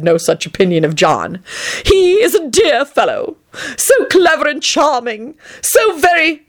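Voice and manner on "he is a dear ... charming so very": higher, slow, gushing dandy voice; Tony, affected and gushing. A narrator's reading. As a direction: lay the charm on thick, drawn out. Tony's voice, affected.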